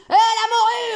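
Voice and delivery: high, loud mock taunt; provoking coach. Coach pokes the dozing bestiole again, loud high taunt. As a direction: jab at her like a crude coach. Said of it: "tu peux remettre un petit coup".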